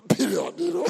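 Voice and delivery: breaking into laughter around the words; laughing. Real, not acted. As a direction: laugh it out, amused by the blunt line. Amused by the publican's blunt prayer.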